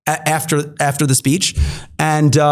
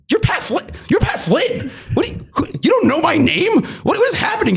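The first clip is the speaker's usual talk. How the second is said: squeaky, much higher cry of disbelief, rising to a shout; high-pitched incredulous fanboy yelp; starstruck disbelief; 13 semitones higher than usual. Retold or imitated. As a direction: gush like a starstruck fan who can't believe it. Starstruck disbelief at Pat Flynn.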